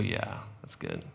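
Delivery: a low, slow, satisfied murmur of glee; smug gloating. Retold or imitated. Preacher imitating a listener's quiet, smug gloating. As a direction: savor someone else's bad luck, slowly. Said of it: "Why do you go".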